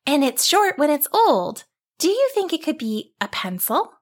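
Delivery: put-on high, bright little girl; curious girl puzzling out a riddle; young girl voice.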